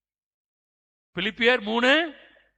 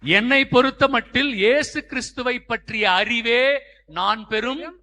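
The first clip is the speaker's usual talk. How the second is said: loud, higher declaiming; verse proclamation; 5 semitones higher, 8 dB louder, slower than usual. Real, not acted. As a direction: proclaim the scripture to the whole crowd. (உரத்த வசனப் பிரகடனம்)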